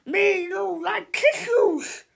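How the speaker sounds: high, loud, slow broken-English scream; screaming monster-speak. A narrator's reading. Melvin refusing tissues, terrified scream. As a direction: howl it like a scared brute. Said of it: "screamed Melvin".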